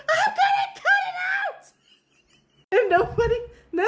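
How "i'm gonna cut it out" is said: put-on screaming boy. Boy yelling at the family, high panicked shriek. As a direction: shriek it out like a frantic kid. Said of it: "at the top of his legs".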